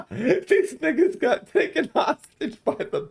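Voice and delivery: squeaky high words broken up by real laughter; laughing. Real, not acted. Telling the crew their friends got held hostage, laughing. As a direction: laugh your way through the news, slow and high.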